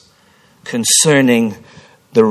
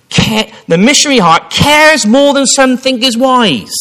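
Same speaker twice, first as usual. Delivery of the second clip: emphatic reading; 11 semitones higher, 8 dB louder than usual. Acted. Repeats the saying for emphasis.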